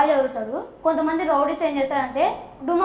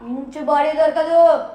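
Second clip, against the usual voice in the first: Balayya dialogue. Retold or imitated. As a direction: deliver it like a mass hero threatening a villain. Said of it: "నేను కూడా బాలయ్యనే అక్క".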